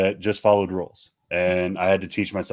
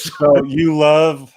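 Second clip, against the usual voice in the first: teasing drawl; 7 semitones higher, 6 dB louder, much slower than usual. Real, not acted. Teasing segue into topic.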